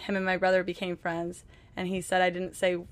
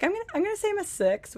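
quick, higher retelling of husband's joke; a light imitation of her husband, fast; quoting husband; 12 semitones higher, faster than usual. Wife lightly imitating her English husband's line, quick and high. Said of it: "funny the way he says it".

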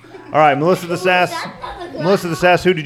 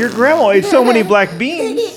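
high, giggling through the words; laughing; 4 dB louder, slower than usual. Real, not acted. Host laughing at the kid's farting grandma, giggly high voice.

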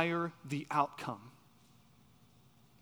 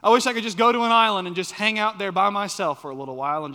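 fast, louder, raised outpouring; acted exasperated wish; 4 semitones higher, 7 dB louder, faster than usual. Preacher voicing a wish to escape circumstances, acted exasperation. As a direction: rattle it off like someone fed up.

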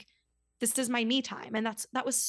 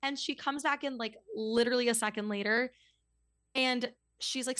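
ordinary delivery

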